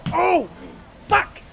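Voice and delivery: high startled yelp and curse; sudden yelp. Real, not acted. Dying in the game mid-story, startled high yelp. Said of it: "as I was dying".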